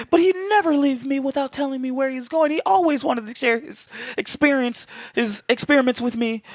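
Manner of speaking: high falsetto wife impression; squeaky falsetto plea; mock wife voice. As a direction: pitch it way up like a pleading wife. S0 voicing wife in retold scene.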